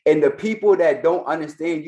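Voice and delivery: ordinary talk, nothing marked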